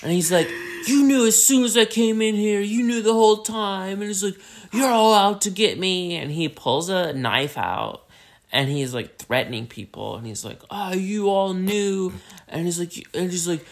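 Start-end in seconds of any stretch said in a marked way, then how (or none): 0.9-4.4 high, loud acted rant; paranoid accusation. Cornered man accuses the bar, high loud rant. 4.7-6.1 high, loud mock accusation; paranoid outburst. Man turns on the bar people, high paranoid cry. 10.7-12.1 high, slow drawn-out accusation; acted threatening accusation. Man with knife threatens people, high slow accusation.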